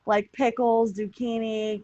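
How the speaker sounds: ordinary talk, nothing marked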